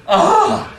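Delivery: a sudden high, loud yelp of fright; startled yelp. Retold or imitated. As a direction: yelp as the jet leaps up under you. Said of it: "and you go".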